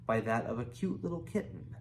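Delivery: ordinary delivery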